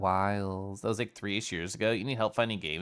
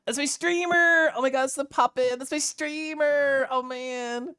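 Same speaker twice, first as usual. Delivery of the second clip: high, slow excited squeal; regular excited take; 4 dB louder than usual. Pretend. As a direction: cheer it like a thrilled fan. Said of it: "That's probably, like, the most regular one".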